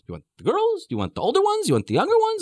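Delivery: high-pitched, mock accommodating questions; haggling. Kidnapper haggling with an armed parent, haggling. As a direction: offer options in a high, wheedling lilt.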